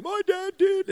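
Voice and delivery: put-on very high, squeaky kid voice, loud; mock child's voice blaming dad; imitating his son. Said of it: "he'll be an adult getting counselling".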